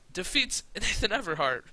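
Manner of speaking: high, loud laughing announcement; laughing in surprise. Real, not acted. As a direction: announce it through real laughter. Laughs at surprise title result.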